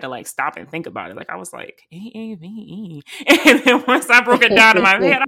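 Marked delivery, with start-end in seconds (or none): 1.9-3.0 slow, drawn-out sounding out of letters; slow and pondering; puzzling it out. Retold or imitated. Stops to puzzle out the term. 3.3-5.2 loud, higher, through real laughter; amused at yourself, laughing loudly. Real, not acted. Laughing at own slow realization.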